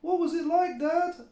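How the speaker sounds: put-on high curious child voice; a small kid asking, wide-eyed; little kid goat. A narrator's reading. Billy the Kid asks dad, high and curious. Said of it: "asked Billy the Kid".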